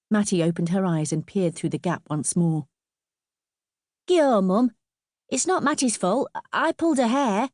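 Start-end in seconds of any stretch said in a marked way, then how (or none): 4.1-7.5 put-on high young lad begging his mum; urgent, high-pitched schoolboy appeal; pleading boy. A narrator's reading. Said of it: "still pleading with his mother".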